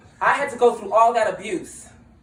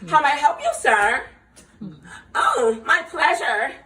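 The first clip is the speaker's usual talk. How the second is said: higher, sweet customer-service voice; polite server voice; 5 semitones higher than usual. Retold or imitated. Mocking how servers greet guests, sugary and high. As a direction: play the eager-to-please hostess.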